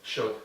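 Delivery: should dicho sin la L, como /ʃud/; should without L. Shown as a demonstration. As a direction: say should with no L sound. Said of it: "No pronunciar la L". (should sin pronunciar la L)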